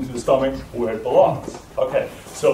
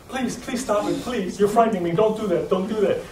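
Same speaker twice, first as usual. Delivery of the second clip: frightened pleading; 7 semitones higher than usual. Retold or imitated. Woman begging the two men to stop, frightened plea. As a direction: plead small, head ducked. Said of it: "ducked her head and said".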